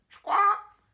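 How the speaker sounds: shrill, very loud high squawk; parrot squawk. A narrator's reading. Parrot screeches before repeating the monkey, very loud. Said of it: "the parrot repeated".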